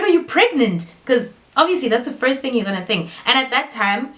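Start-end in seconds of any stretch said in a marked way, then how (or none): 0.0-0.9 higher, louder, alarmed; shocked question. Retold or imitated. Sister asking her sister if she's pregnant, shocked. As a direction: blurt it out in alarm.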